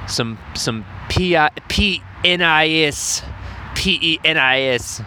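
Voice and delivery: P E N I S spelled slowly letter by letter; slow letter-by-letter spelling of P E N I S. Shown as a demonstration. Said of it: "spelling the word out letter by letter".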